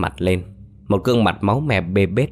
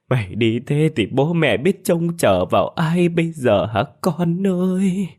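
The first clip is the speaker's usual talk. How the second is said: high, keening funeral cry; mother mourning her son aloud; wailing lament; 8 semitones higher than usual. Acted. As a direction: sob and plead over the body. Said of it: "khóc lên khóc xuống vì xót con".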